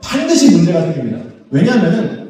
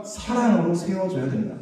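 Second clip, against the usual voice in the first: soft answer; 7 dB quieter than usual. Acted. Preacher answers his own question.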